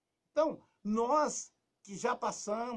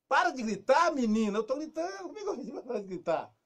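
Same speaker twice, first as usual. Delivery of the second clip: higher, louder parent yelling; mock yelling parent; 6 semitones higher, 6 dB louder than usual. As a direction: shout back at the shouting girl. (mãe gritando com a filha, fingida)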